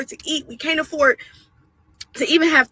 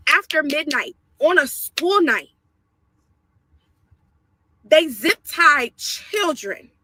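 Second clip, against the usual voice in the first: normal talking voice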